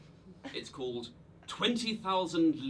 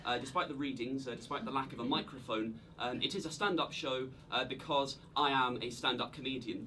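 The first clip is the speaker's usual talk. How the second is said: usual speaking voice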